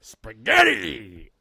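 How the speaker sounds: slow loud drawn-out shout; Al Pacino impression. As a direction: bellow it like Al Pacino. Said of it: "Al Pacino".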